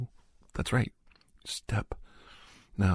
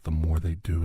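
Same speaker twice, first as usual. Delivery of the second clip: ordinary talk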